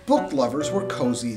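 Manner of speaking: ordinary delivery, nothing marked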